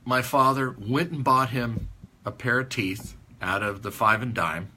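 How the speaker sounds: normal talking voice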